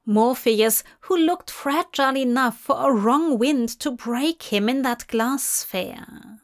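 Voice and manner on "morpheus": ordinary delivery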